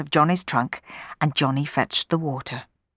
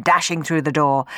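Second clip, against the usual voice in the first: ordinary delivery, nothing marked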